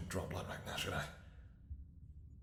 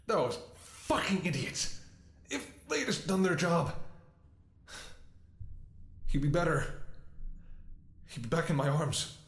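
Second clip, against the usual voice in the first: furious grief; 6 semitones higher than usual. Acted. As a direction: spit out the blame. Raging at those who neglected him.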